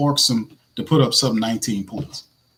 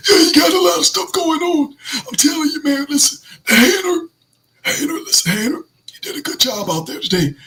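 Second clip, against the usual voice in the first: high raspy coach impression; Rizzy voice impression; 12 semitones higher than usual. Host imitating the head coach at a press conference, high and raspy. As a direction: play the coach at the podium, raspy. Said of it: "go to the Rizzy levels of raspiness".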